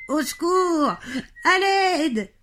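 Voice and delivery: high, wailing fake distress; hammed-up moaning. Jean-Claude pretends to be hurt, moaning theatrically. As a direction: wail for help as if wounded. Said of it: "se met à gémir".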